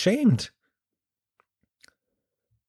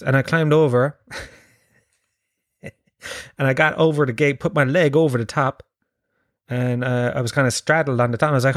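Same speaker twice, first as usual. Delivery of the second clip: ordinary talk, nothing marked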